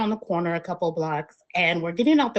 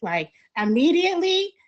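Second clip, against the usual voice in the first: ordinary talk, nothing marked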